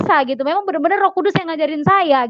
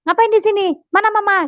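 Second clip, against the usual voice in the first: startled questioning; 8 semitones higher than usual. Retold or imitated. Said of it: "Suami saya kaget".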